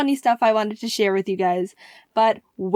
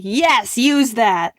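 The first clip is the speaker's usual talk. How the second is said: retold enthusiasm; 4 dB louder, much slower than usual. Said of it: "I was like".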